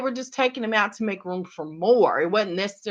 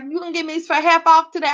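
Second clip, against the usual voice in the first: joking ask; 9 semitones higher, 4 dB louder, much faster than usual. Retold or imitated. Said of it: "I was like".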